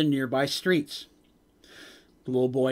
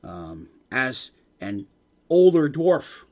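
normal talking voice

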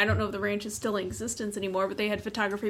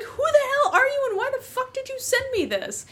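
exasperated yell. Retold or imitated. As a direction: squawk it out, laughing in disbelief. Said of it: "I didn't mean to yell at you".

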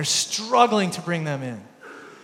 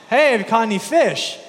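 higher, louder shout across the water; calling from shore; 6 semitones higher, 7 dB louder than usual. Retold or imitated. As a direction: call out to the boat. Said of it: "Jesus yells out".

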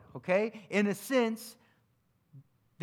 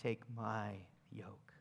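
soft, much quieter Jesus words; softer voicing of Jesus; tender Jesus; 8 semitones lower, 12 dB quieter, slower than usual. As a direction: hush it down, tenderly. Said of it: "Jesus is saying".

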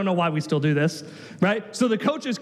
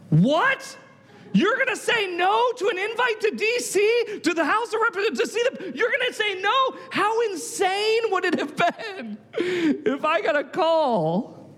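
high-pitched, drawn-out disbelief; incredulous mock squeal; acted incredulous outburst; 11 semitones higher, slower than usual. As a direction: react like someone aghast at a turned-down invitation. Gut reacting to a refused invitation.

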